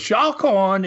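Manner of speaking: high Aussie warning, R dropped in "Shark"; imitated Aussie accent. Aussie surfer's third warning to a swimmer, imitated Aussie accent. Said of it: "that accent".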